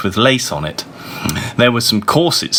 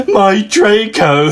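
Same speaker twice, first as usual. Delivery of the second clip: high, loud, slow wail; hammed-up moan, much higher and drawn out; 10 semitones higher, 5 dB louder, slower than usual. A narrator's reading. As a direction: wail it out in grief. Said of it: "he moaned".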